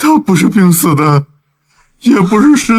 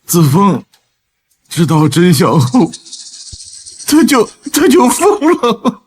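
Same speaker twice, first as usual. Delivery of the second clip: hesitant stammering; 2 semitones higher than usual. Acted. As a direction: falter between phrases, reluctant to finish. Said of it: "别吞吞吐吐".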